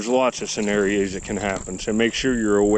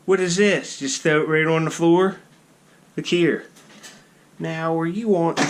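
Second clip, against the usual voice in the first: high-pitched coaxing of a pet; pet talk; 8 semitones higher than usual. Real, not acted. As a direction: talk up high to your little animal. Talking to his pet squirrel.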